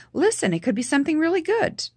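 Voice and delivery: bright, high, selling hope to her brother; forced convincing cheer. Retold or imitated. Reassuring her sick brother it could be good, forced cheer. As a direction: put on a hopeful face and voice. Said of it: "Convincing face".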